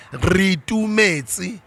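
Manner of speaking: Ritumetse drawn out slowly with a rising end; slow name drill. Shown as a demonstration. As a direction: teach Ritumetse slowly, syllable by syllable. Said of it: "Your name is Ritumetse".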